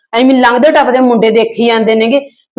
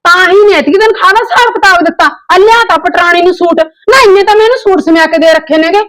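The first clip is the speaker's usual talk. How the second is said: higher, scornful taunting; sarcastic jibe; 6 semitones higher than usual. Acted. Aunt mocking the suit brought for the niece, sarcastic. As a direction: sneer at the brother's gift.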